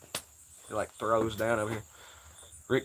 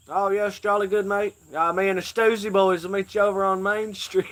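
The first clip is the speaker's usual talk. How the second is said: high, hammed-up British accent; mock British accent; 7 semitones higher than usual. Streamer doing a mock British accent on a friend's dare. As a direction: play a silly British bloke. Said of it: "Slap a funny accent on you".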